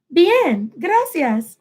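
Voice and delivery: high, lilting reply; light, high put-on thanks; second character's voice. Shown as a demonstration. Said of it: "I will change my voice".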